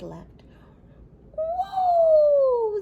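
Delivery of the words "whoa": much higher, much louder whoa; sputtering. A narrator's reading. Grandpa swerving behind his scarf, high loud whoa. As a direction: cry out as the sled swerves. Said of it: "sputtered Grandpa Dan".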